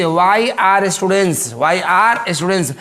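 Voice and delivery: "why are students" drawn out slowly; teacher slowly reading the exercise; slow dictation. Shown as a demonstration. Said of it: "देखिए पहले ही से".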